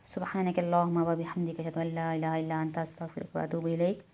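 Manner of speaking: a quiet recited dua to close; closing prayer. Acted. Teacher closes the lesson for students with a soft recited prayer.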